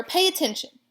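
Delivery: high and loud command; urgent yell. A narrator's reading. Pig yells at rat to listen. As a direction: snap him to attention. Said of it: "screamed Wilbur".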